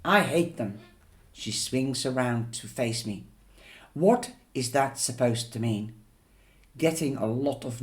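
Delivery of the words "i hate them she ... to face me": ordinary delivery, nothing marked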